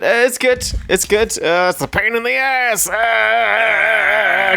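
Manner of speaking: high puppet voice; Muppet voice. Acted. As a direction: play a cheeky Muppet. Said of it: "That's my Muppet voice".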